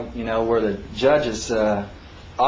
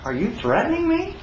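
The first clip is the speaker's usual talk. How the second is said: high, indignant rising challenge; indignant question; 11 semitones higher than usual. Retold or imitated. Defendant confronting the prosecutor, high indignant question. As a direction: throw the question back in disbelief. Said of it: "And I says".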